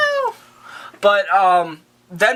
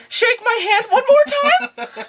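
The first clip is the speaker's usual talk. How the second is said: extremely high, drawn-out silly plea; squeaky hammed-up request, stretched slow; mock Bruce Wayne; slower than usual. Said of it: "Bruce is like".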